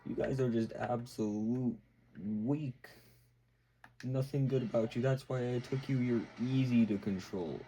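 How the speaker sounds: mocking villain. Vecna taunting the kids he took, a lightly voiced slow sneer. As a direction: sneer down at the weak kids, dragging the words. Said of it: "He's like".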